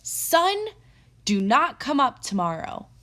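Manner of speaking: loud, high call to the sun; loud command. Acted. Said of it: "spoke in a loud voice".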